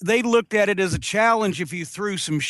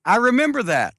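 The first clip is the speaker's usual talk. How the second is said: said again, higher and louder, to be heard; louder repeat for a listener who missed it; 4 semitones higher than usual. Real, not acted. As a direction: raise it so he catches it.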